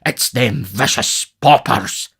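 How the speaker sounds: slow, pompous condemnation; majestic disdain. A narrator's reading. Beadle blames the paupers, majestic disdain. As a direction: pronounce it slowly with self-important majesty. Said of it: "checking himself with native majesty".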